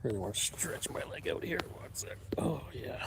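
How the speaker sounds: soft whisper. Real, not acted. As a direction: barely whisper it. Quietly stretching his leg.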